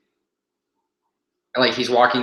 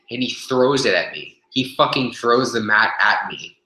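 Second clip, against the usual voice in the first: ordinary talk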